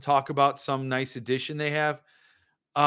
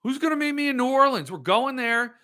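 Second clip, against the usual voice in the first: higher and fast, giddy about the trip; gleeful road trip cheer; excited whoop; 8 semitones higher than usual. Real, not acted. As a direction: call out to anyone who'll come along. Hollering about a New Orleans trip.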